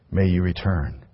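ordinary delivery

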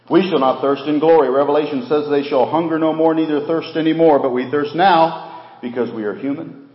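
loud, high proclamation; fervent preaching. Acted. Preacher declaring heaven to the church, loud and fervent. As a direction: lift it up to the back row.

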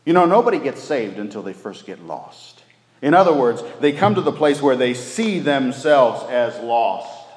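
fervent pulpit delivery; full-force preaching. Acted. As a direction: preach it out to the back pews.

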